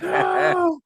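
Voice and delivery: high, long mock shout; hammed-up wail held on one high note; imitated movie scream. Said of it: "he's like".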